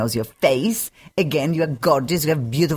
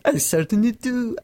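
put-on playful echo of a British accent, higher; 6 semitones higher than usual. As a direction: mimic the guest's British accent. Host playfully echoes guest's accent.

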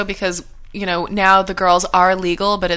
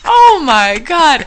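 excited; 7 semitones higher, 7 dB louder, much slower than usual. Real, not acted. Laughing at her own story.

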